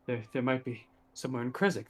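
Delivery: usual speaking voice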